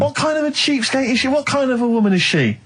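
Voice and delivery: pitched high, sarcastic indignation; Carl's co-host feigning outrage at the wife, mock-scandalised. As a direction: play scandalised on his behalf, tongue in cheek. Mock outrage at wife's fussiness.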